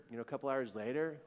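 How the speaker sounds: ordinary talk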